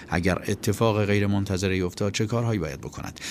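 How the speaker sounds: ordinary delivery, nothing marked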